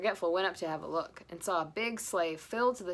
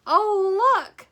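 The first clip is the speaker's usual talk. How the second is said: much higher, louder delighted cry; cried; 13 semitones higher, 15 dB louder than usual. Acted. Phoebe spotting the sleigh, delighted. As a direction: squeal with wonder. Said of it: "Phoebe cried".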